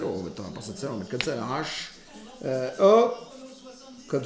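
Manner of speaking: very low, soft mumble; under-the-breath kitchen muttering; talking to himself. Real, not acted. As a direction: mumble along as you measure. Mumbles to himself while adding salt.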